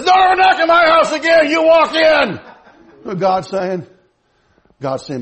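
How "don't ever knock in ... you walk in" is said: high, loud grandma yell, imitated; hollered household command; scolding shout. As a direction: lay down the law at full volume.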